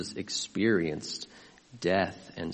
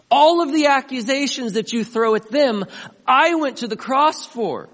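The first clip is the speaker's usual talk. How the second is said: loud, high, commanding rebuke; Jesus rebuking the accuser at full volume; 14 semitones higher, 10 dB louder than usual. Acted. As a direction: face down the accuser for your family.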